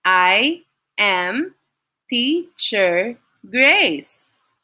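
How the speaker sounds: "I am Teacher Grace" in five slow, separated beats; syllable by syllable. Shown as a demonstration. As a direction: count out "I am Teacher Grace" with a pause after each syllable. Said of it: "That's five syllables. Again".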